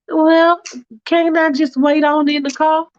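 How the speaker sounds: a fussy woman hedging politely; Faith's fussy voice. Acted.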